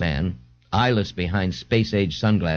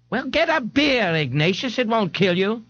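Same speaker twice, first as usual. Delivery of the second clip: much higher-pitched mother character voice; exasperated mother; 13 semitones higher than usual. A narrator's reading. A mother urges her son to take a beer, exasperated. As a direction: coax him in a high motherly voice.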